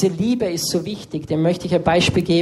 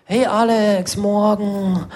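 mystical teacher; 3 semitones higher, slower than usual. Retold or imitated. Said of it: "so mystisch gesprochen".

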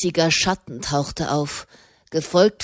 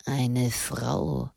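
low, soft murmur to himself; 5 semitones lower, 7 dB quieter than usual. A narrator's reading. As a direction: mutter it low, barely voiced. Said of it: "murmelte er".

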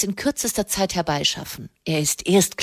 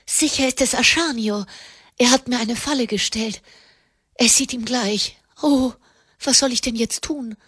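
high, thin panic, voiced rather than whispered; frightened whispering; 6 semitones higher than usual. A narrator's reading. Wife fearing her husband has trapped her, frightened. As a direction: fret in a high, anxious voice. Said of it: "flüsterte sie".

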